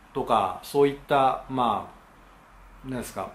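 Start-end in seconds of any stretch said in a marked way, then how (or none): none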